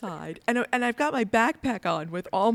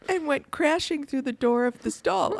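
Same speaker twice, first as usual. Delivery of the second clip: laughing while telling it; 6 semitones higher, 3 dB louder than usual. Real, not acted.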